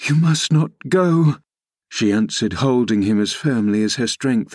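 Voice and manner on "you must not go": high, insistent command; desperate insistence. A narrator's reading. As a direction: forbid him to leave, pitch raised. Catherine clinging, refusing his departure.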